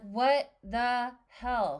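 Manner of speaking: slow, stretched-out bafflement; drawn-out puzzled what the hell. Retold or imitated. Telling a friend about a vanished coworker, slow baffled. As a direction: drag the words out, baffled. Retelling her confusion.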